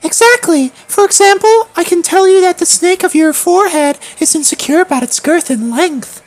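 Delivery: high, odd character voice. Acted. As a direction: pitch it up into a strange creature. GM voicing a character.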